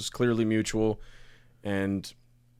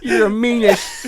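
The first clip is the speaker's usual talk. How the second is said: mock mispronunciation; 13 semitones higher, 11 dB louder, slower than usual. Mocking a misspoken word.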